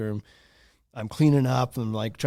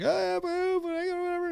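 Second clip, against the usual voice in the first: mock crying kid; 5 dB quieter than usual. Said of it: "He's, like, crying".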